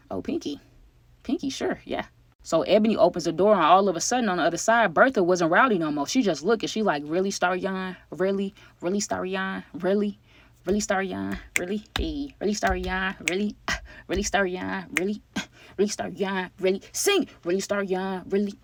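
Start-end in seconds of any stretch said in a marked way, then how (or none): 0.1-2.0 higher, softer pinky swear; swearing to the girlfriend sweetly; high sing-song promise. Retold or imitated. 7.0-18.5 a touch of hurt ex nagging; mock repeated disbelief. Repeated disappointed ex.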